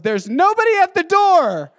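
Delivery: high, loud hammed-up stage line; Grant. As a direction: deliver one line with big mock drama. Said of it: "his line was".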